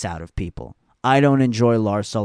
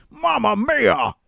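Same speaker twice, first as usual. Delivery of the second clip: mock Italian; 10 semitones higher, slower than usual. Joking Mario-style exclamation.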